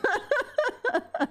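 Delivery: long, high peal of giggles; high helpless laugh. Real, not acted. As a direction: keep cracking up. Keeps laughing at accent attempt.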